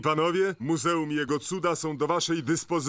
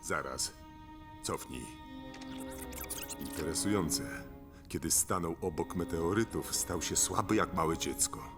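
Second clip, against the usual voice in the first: low, soft, scheming murmur; hushed villain working out a weakness; intrigued musing; 9 semitones lower, 8 dB quieter than usual. Acted. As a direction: think aloud quietly, pitched well down. Villain muses over recorded footage.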